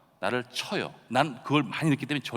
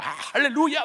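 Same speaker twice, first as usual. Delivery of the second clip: raised, half-strength revival hallelujah; mock preaching shout; 10 semitones higher than usual. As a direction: imitate your own shouted preaching, held back. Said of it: "소리를 지르면서".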